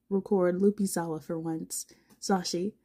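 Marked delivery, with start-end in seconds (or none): none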